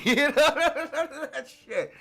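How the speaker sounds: slow, laughing through the words. Real, not acted. Laughing after mocking gang rap lyrics. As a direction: giggle it out.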